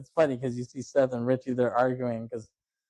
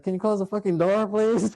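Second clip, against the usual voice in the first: mock-annoyed, high-pitched demand; lightly acted high, fed-up request; retold exasperated plea; 9 semitones higher than usual.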